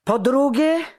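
high, loud angry outburst; furious shout. A narrator's reading. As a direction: yell it out in a fury. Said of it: "krzyknął czekan w nabrzmiałej ręce ściskając".

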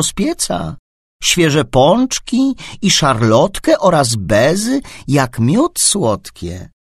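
lively singsong reading voice; reading to children. A narrator's reading. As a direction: read it bright and bouncy for a child. Reads a rhyming story to a child.

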